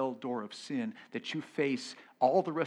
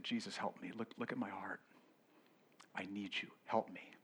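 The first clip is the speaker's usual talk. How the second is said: softer, hushed plea to Jesus; quiet prayer; 4 semitones lower, 9 dB quieter than usual. Retold or imitated. Preacher reliving his vacation prayer, softly pleading. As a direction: murmur a humble cry for help.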